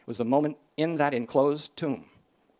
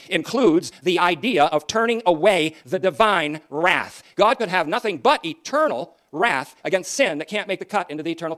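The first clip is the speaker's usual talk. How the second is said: louder, insistent pulpit voice; fiery preaching; 3 semitones higher, 7 dB louder than usual. Acted. Preacher expounding propitiation to listeners, fiery preaching. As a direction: hammer home the wrath being turned away.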